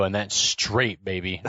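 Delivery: low slow drawl. Retold or imitated. Jokey tough-guy line.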